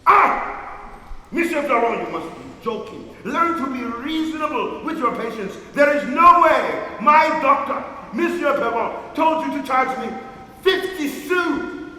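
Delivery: loud, high indignation at a price; outraged protest. Acted. Invalid disputing the apothecary's bill, outraged.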